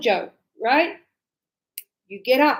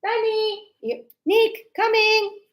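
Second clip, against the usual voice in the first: calling out names; 8 semitones higher than usual. Shown as a demonstration. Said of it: "If I go".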